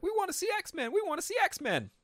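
put-on high, eager child's plea to see the movie; kids begging. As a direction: chant it like a little kid begging dad. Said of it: "Me and my brother are like".

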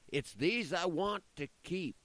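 furious roar, high and loud. Acted. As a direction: roar it with all his fury. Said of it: "Satan roared".

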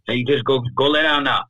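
lightly voiced drunk parent; parent shooing kids. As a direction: shoo the kids off to bed.

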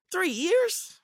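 high, disbelieving squeak; incredulous. Real, not acted. To a friend who would flee prison, high incredulous squeak. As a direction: scoff at the idea of only three years.

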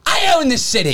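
high mock drunk boast; hammed-up drunk local, way up high; drunk entitlement. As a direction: crow it like the city is yours. Voicing drunk Devin.

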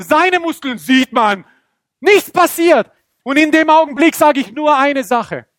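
high, loud climactic telling; straining excitement. Acted. Preacher telling the deadlocked match, high strained excitement. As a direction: build the tension at full pitch.

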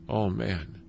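normal talking voice

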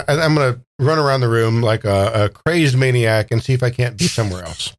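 normal talking voice

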